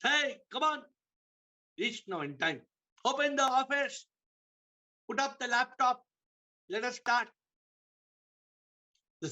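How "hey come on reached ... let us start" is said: high, pushy orders; impatient boss. Acted. Businessman barking orders at his staff, high and impatient. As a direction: hurry everyone along.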